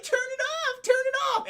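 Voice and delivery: high-pitched frantic plea; mock panicked kids. Imitating the kids begging over the flashlight, panicked. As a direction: squeal it like frightened kids.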